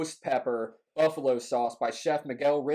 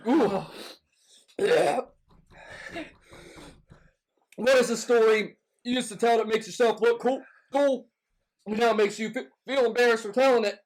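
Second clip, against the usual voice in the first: mouth burning from spicy food; 6 semitones higher than usual. Real, not acted. Talking through hot sauce burn.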